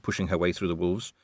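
normal talking voice

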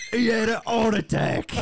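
high-pitched Cockney wail; Arthur. Acted. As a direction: blurt bad news in hammed-up Cockney. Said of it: "Arthur, uh, and you have to go tell".

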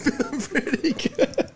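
high, slow sarcastic echo; mocking echo. Friend mockingly echoes the line.

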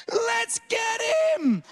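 high, slow rallying cry; rally bystanders like a hammed-up war leader; drawn-out high mock battle cry; imitated battle cry. Wife rallying bystanders to chase.

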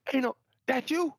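high squealing imitation of an excited woman; mock high fangirl voice; excited fan. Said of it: "She said".

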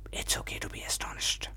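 breathy secret whisper. Acted. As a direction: whisper it close to the ear. Intimate aside to listeners.

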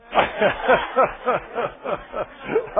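hearty laugh; real amused laugh. Real, not acted. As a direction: burst out laughing. Laughing at his own story.

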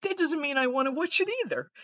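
high comic voice; mock confession. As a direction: squeak it out like a silly admission.

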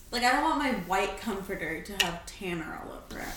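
fast, hushed whisper. Real, not acted. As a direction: whisper it quickly. Whispered worry.

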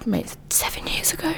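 hushed whisper of disbelief. Real, not acted. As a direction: whisper it, stunned. Shocked aside about time passing.

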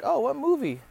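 high-pitched mock wife question; imitated wife. Wife asks husband about his check, imitated wife. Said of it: "his wife would be like".

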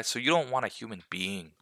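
ordinary talk

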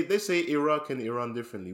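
normal talking voice

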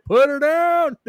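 hammed-up rowdy couch cheer; mock cheering on. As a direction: cheer the cops on like a rowdy fan. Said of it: "You know what I'm saying?".

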